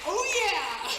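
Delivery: loud, high, triumphant; like it suddenly clicks, ready to fight; fired-up realization. Retold or imitated. Realizing she has weapons.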